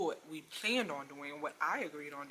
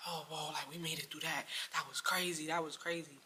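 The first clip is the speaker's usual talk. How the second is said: usual speaking voice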